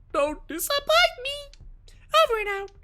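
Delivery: put-on very high, slow cute anime girl. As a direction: squeak it out slowly like an anime girl. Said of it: "we give him an anime girl voice".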